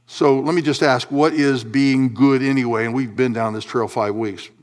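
usual speaking voice

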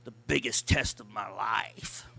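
slow, hushed whisper; breathy mock whisper of complaint. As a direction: whisper it like a grumbled secret. Husband muttering complaint.

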